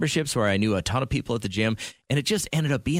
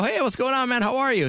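cheery greeting; 13 semitones higher than usual. Retold or imitated. Greeting his dentist at gym.

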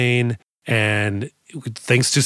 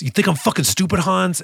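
higher-pitched acted taunt; John McClane; 6 semitones higher than usual. Hero outsmarting the villain, acted taunt. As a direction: throw the line at the villain. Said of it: "John McClane says".

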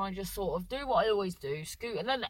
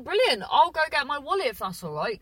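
cheerful, a bit louder and higher; bright eager reply; 5 semitones higher, 6 dB louder than usual. Retold or imitated. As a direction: sound pleased and keen to go. Cheerful offer to the mechanic.

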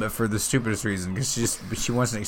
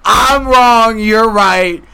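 high, loud, drawn-out wrestler roar; Stone Cold-style yell; 14 semitones higher, 13 dB louder, much slower than usual. Retold or imitated. Boyfriend agreeing with his patriotic fiancée, wrestler-style roar. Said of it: "it's like".

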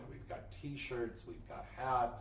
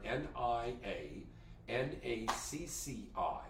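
spelling it out; slower than usual. Real, not acted. Spelling out the OnlyFans address.

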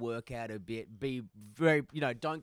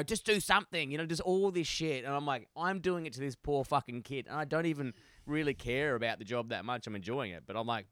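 ordinary talk

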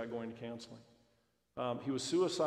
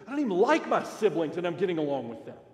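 put-on amazed teen; 4 semitones higher, 10 dB louder than usual. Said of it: "And he goes".

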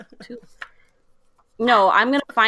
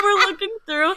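high, breaking into laughter; laughing; 9 semitones higher than usual. Real, not acted. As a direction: laugh through the words. Laughing at old photos.

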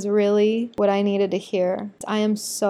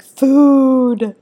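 loud, higher, hungry cry of food; eager shout; 4 semitones higher, 9 dB louder than usual. Retold or imitated. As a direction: call out for the food like a starving kid. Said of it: "the half of the girls that are like".

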